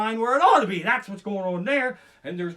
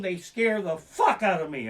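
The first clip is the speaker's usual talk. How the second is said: normal talking voice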